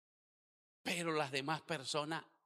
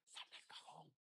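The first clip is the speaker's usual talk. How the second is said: whispered command; 16 dB quieter than usual. Retold or imitated. Commanding the dead man.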